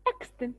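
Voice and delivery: "Extant" with stress slipped onto EX; mispronounced extent. Real, not acted. Teacher reading the second word to class, mispronounced with first-syllable stress. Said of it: "sorry, extent".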